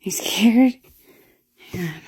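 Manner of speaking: urgent whisper. Real, not acted. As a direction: whisper it nervously. Whispering in dark house.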